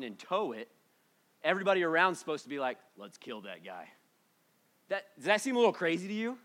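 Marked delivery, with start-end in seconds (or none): 3.0-3.9 low, very soft aside; muted menace. Acted. Crowd quietly agrees to kill whoever touched the mountain, muted. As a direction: drop right down, understated and deadpan.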